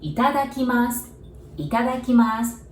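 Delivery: "Itadakimasu" said clearly, syllable by syllable, in Japanese; teacher models "itadakimasu" as ee-ta-da-kee-mahs, the final u nearly silent; Japanese word demo. Shown as a demonstration. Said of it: "We said".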